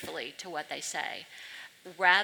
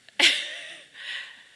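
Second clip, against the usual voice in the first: high, loud real laugh; a bright burst of laughter; laughing hard at her own story; 6 dB louder than usual. Real, not acted. Laughing at the retold exchange.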